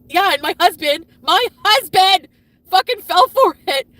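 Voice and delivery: high, very loud outraged shouting; real furious outburst. Real, not acted. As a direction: shout it in outrage, stressing each word. Furious that husband fell for it.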